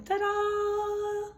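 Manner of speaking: high playful fanfare; sing-song ta-da. Pretend. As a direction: announce it like a magic trick. Announcing the parade.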